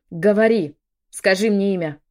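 loud snarl through the teeth; growled demand. A narrator's reading. Poisoned woman demands an answer from maid, growled demand. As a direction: force it out as an angry order. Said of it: "прорычала я сквозь зубы".

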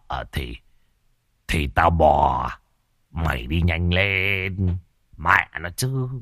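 put-on a frightened old father stalling his son; old father Long. (ông Long thì thào giục con ra cổng)

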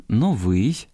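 Новий with a rising, stressed -вий; stress on last syllable. Shown as a demonstration. Host repeats the correct form, final-syllable stress on Новий. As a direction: lean on the end of Новий. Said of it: "я зараз правильно читаю".

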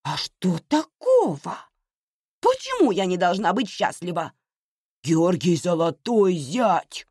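mother defiantly talking back. A narrator's reading. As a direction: defend yourself with indignant relish. Mother defends herself defiantly in quarrel.

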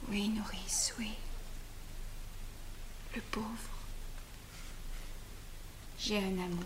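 soft whisper. Acted. Confessing to nurse.

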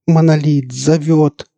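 high, loud, slow ecstatic call; rapturous, drawn-out shout; fanatical cry. A narrator's reading. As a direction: proclaim it in a possessed frenzy. Entranced elf cries out before collapse.

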